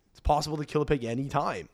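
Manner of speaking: ordinary talk, nothing marked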